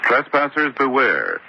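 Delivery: usual speaking voice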